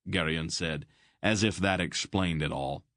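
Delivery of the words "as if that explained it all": usual speaking voice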